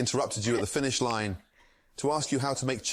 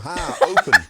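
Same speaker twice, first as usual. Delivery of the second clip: laughing; 11 dB louder than usual. Real, not acted. Chef bit breaks into laughter.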